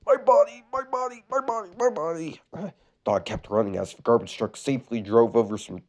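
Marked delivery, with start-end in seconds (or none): 0.1-2.4 high, bumping-cat yelps; high goofy cartoon cries; cartoon yelling. A narrator's reading. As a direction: yelp it each time you hit something.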